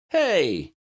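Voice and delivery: high, sharp indignant Hey; indignant cry. A narrator's reading. Lydia protecting her chicken, a high indignant cry. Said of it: "cried Lydia".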